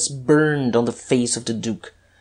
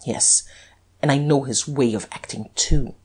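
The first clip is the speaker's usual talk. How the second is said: usual speaking voice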